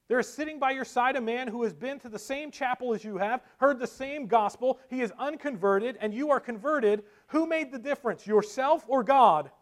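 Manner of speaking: high, pressing pulpit questioning; fervent preaching. Acted. Spurgeon questioning the Methodist brethren, preaching fervently. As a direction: build toward a challenge to the hearers.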